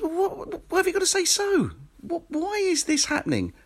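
bewildered, mock-annoyed squeak; exasperated inner voice. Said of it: "and you think".

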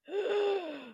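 high, sharp mock-horrified gasp; hammed-up shocked gasp. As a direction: gasp as if scandalised. Mock shock at CDC warning.

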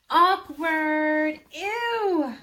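loud, high sing-song taunt; mocking. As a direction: jeer like a schoolgirl, drawn out and high. Girls mock her fall.

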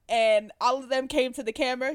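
ordinary delivery

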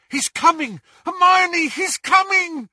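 high, loud alarmed yell. A narrator's reading. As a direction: yell the warning to her. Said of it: "As he yelled".

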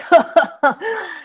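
short high mocking laugh; a guard's scornful chuckle. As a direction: laugh down at the little donkey. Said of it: "looked at small one and laughed".

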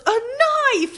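put-on excited kid. Retelling a Vine, little boy shouts gleefully at mom, high. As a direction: yell it like a delighted toddler. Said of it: "And he goes".

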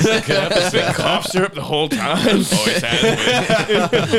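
laughing. Real, not acted. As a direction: laugh out the joke. Laughing at cough syrup joke.

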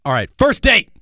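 high, loud squeal of excitement; excited yell. Acted. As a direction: belt out the words with glee. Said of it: "What the hell was that?".

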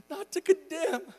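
high, loud, heartfelt preaching; impassioned preaching. Real, not acted. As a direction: proclaim acceptance with full-voiced fervour. Preaches at full force.

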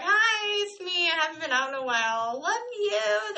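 high, bright, fast little-voice hi it's me; cutesy greeting. Retold or imitated. Greeting friends after hiding away, high cutesy voice. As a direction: play it sweet and sheepish.

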